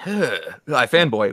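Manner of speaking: slow, refined posh British accent; upper-crust British accent. Shown as a demonstration. The upper-class British version of the greeting. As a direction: drawl it like British nobility. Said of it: "that's more upper crust".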